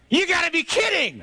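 high, exasperated holler; incredulous yell. Acted. Rick to his boatmate in the storm, incredulous shout. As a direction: shout it back in disbelief over the wind.

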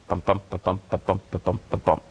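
"bump, bump" tapped out in an even, bouncing iambic rhythm; iambic beat. Shown as a demonstration. Poet demonstrating iambic movement to the host, "bump, bump" in rhythmic pulses. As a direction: beat out each "bump" like a metrical foot. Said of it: "that iambic movement".

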